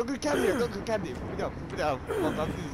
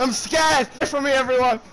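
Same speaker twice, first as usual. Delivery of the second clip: panicked shout; 7 semitones higher, 13 dB louder than usual. Real, not acted. Panicked in game.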